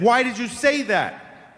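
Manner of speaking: high, slow, stern demand; imitated furious mother. Benji's mother confronting the seven-year-old, mock furious. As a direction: confront a child as an outraged mother would. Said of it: "and she's furious".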